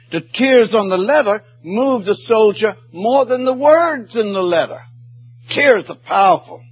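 high ringing preacher's climax; fervent pulpit cry; impassioned preaching. Acted. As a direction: soar up and drive it home.

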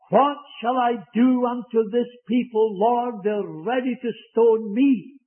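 high, loud desperate prayer of a hunted leader; pleading Moses. Acted. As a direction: beg the Lord for help as the crowd turns. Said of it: "The plea of Moses".

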